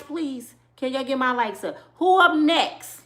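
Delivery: ordinary talk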